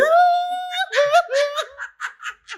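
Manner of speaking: a long high-pitched squeal of laughter; helpless squeal of laughter. Real, not acted. Laughing remembering the hug.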